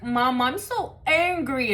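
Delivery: slow, drawn-out imitation of a kid telling mom; imitated angry little boy. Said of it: "and he's like".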